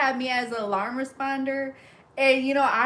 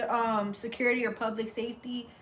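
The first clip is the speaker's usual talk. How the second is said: ordinary talk, nothing marked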